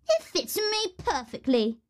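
high-pitched, put-on sister voice; first horrid sister. A narrator's reading. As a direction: boast in a high character voice. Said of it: "said the first".

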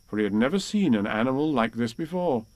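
ordinary delivery

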